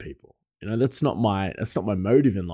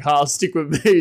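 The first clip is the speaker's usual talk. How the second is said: ordinary delivery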